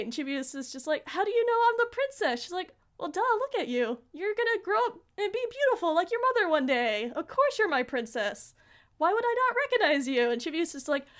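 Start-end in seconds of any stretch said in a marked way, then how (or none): 1.1-2.4 high-pitched imitation of a surprised child; acted-out incredulity; astonished question. Chibiusa astonished at being recognised. 3.0-10.3 higher, playful "of course" delivery; acted-out affectionate duh, swooping up in pitch; obvious reassurance. Pluto answers as if obvious.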